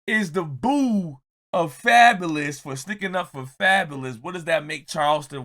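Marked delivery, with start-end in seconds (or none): none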